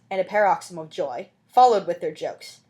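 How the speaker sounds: ordinary delivery, nothing marked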